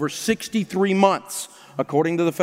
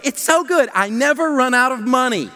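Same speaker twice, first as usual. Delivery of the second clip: put-on high, bright little-girl ad voice, pitched up; 5 semitones higher, 4 dB louder than usual. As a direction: chirp it like a kid in a commercial. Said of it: "she goes".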